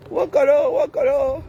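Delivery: high delighted cry; joyful squeal. Real, not acted. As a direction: call it out with glee. Cri de joie. (cri joyeux)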